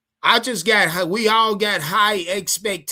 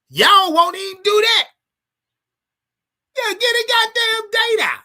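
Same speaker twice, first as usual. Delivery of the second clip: exasperated yell; 11 semitones higher, 4 dB louder than usual. Real, not acted. Demanding Fan Fest date.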